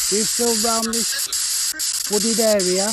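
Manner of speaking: loud, high call into the woods; calling out to spirits. Acted. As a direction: call out to any ghosts nearby.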